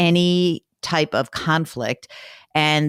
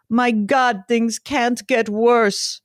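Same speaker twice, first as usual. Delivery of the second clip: put-on Bulgarian accent; 7 semitones higher, much slower than usual. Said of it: "I'll do it with his little accent".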